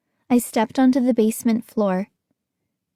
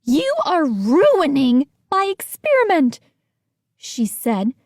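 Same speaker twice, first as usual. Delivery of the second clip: furious scientist; 7 semitones higher than usual. A narrator's reading. Missus B blaming the kids, furious scolding. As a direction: berate them for ruining it.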